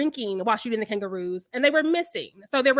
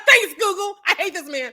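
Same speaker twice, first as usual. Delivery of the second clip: laughing sarcasm; 5 semitones higher, 5 dB louder than usual. Retold or imitated.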